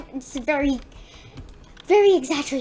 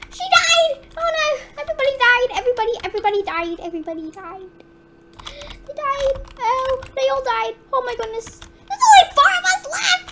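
high-pitched shocked reporting; high, panicky disbelief; distressed alarm; 10 semitones higher, faster than usual. Real, not acted. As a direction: wail it as your team falls. Players dying one after another.

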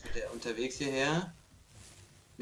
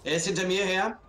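raised alarmed voice; being chased; 6 semitones higher, 5 dB louder than usual. Real, not acted. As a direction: call out while fleeing. Killer chasing him.

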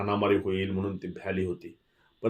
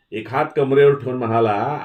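ordinary delivery, nothing marked

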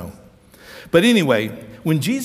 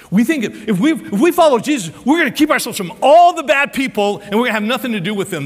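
high, louder, emphatic mock conviction; mock self-righteous; 7 semitones higher, 6 dB louder than usual. Preacher voices religious people shunning bad people, mock self-righteous. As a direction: hammer out the misguided rule, pitched up.

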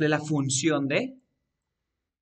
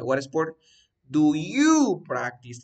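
slow, with "you" drawn out and punched; teacher's slow emphasis on "you"; stress on YOU; 5 dB louder, slower than usual. Shown as a demonstration. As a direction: lean hard on "you" to point out the subject. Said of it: "¿Quién es el sujeto? You".

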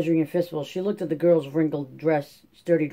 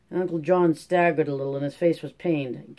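plain reading voice

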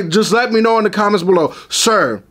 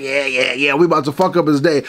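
gobbledygook monster; 8 semitones lower than usual. Retold or imitated. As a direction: growl it out like a cartoon goblin plotting. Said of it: "like the gobbledygook monsters".

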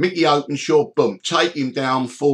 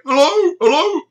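much higher, louder sing-song hello; imitating Derek's high cheery hello; high mock greeting; 6 dB louder than usual. Derek voiced answering phone.

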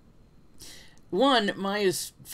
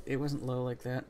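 ordinary talk

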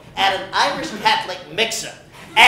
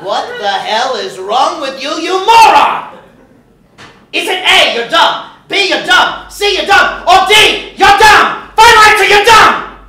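builds ever higher and louder to a yell; mock quiz shout; 6 semitones higher, 7 dB louder than usual. Regis quizzing the linebacker, shouting louder and louder. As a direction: hammer every option like a game-show host gone mad.

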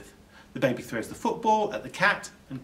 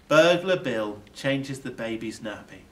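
ordinary delivery

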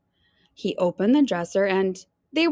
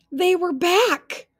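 higher, louder gasped reveal; shocked reveal; 9 semitones higher, 4 dB louder, slower than usual. A narrator's reading. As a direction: hit it like a jump scare, slowed.

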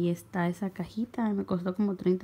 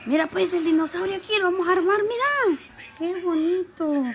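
excited parent talk; 8 semitones higher than usual. Real, not acted. Mother shows her son the toy dinosaur, excited parent talk. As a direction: share the wonder with a little one.

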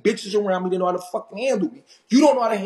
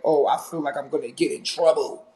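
mocking her words; 6 semitones lower than usual. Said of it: "You're saying, like".

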